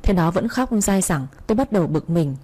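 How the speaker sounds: ordinary delivery, nothing marked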